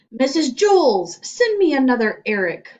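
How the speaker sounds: loud announcement into microphone; calling out. Acted. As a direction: bellow over the intercom. Principal calls over microphone.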